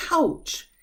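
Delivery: high pained ouch, falling; demo ouch. Shown as a demonstration. Teacher showing how ouch sounds when hurt. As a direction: yelp it like a sudden bump. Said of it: "the sound of when you get hurt".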